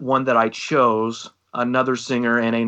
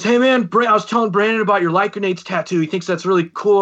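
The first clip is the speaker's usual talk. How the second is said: eager introduction; 8 semitones higher than usual. Retold or imitated. Another singer gushing to Brandon about the tattoo, high and eager. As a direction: gush like you're showing off your friend. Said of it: "And he goes".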